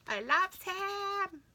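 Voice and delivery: high, loud, slow gushing squeal; excited squeal. Real, not acted. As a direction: squeal with pure delight. Gushing over little hedgehog.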